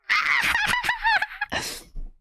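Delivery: very high squealing laugh; shrieking laugh. Real, not acted. Shrieking laugh after joke.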